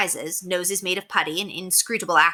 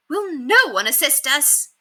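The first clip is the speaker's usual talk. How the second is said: cry for help; 8 semitones higher, slower than usual. A narrator's reading. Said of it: "Penelope cried".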